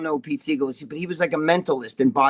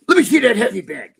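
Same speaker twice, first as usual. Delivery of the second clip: raised, louder mock challenge; higher, punchy imitation of a fired-up drinker; Jimmy as gunfighter; 5 semitones higher, 5 dB louder than usual. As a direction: act out the drunken friend turning gunfighter. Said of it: "the fucking gunfighter in Jimmy comes out".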